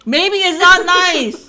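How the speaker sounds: loud, high, drawn-out protest; playful indignation. Real, not acted. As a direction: holler it back in mock outrage. Protesting the daughter's answer "Maybe.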